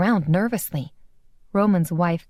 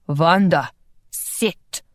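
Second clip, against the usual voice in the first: firm order; much slower than usual. Acted. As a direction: give a short, firm order. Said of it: "Roman ordered".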